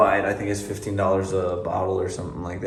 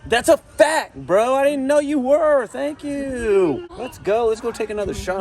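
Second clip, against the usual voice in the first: excited; 12 semitones higher than usual. Real, not acted. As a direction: hype it up like a fan. Excited thanks to subscriber uncle.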